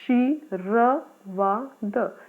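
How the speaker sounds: शी र वा द spoken very slowly, each syllable separate. Shown as a demonstration. Teacher sounding out a word for students, slow and syllable by syllable. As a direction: spell out शी र वा द slowly, pausing after every syllable. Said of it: "हम लोग इसका उच्चारण करके देखते हैं".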